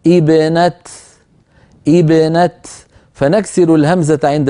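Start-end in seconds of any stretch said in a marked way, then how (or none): 0.1-2.5 ابنة begun with ibne, the opening hamza given kasra. Shown as a demonstration. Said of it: "نقول".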